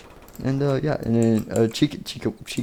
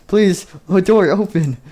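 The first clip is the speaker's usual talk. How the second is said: high-pitched acted begging; desperate plea; 7 semitones higher, 4 dB louder than usual. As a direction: beg frantically, pitched up. Trapped characters beg the door.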